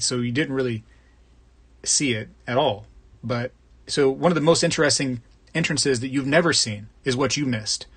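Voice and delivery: ordinary talk, nothing marked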